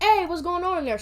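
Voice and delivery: loud, high mock teacher shout; imitating teacher calling out. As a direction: call in like a teacher checking on noise. Teacher calls into the bathroom, retold.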